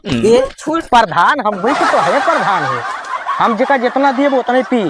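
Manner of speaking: slurring and laughing after a few drinks; drunk with hiccups. Acted.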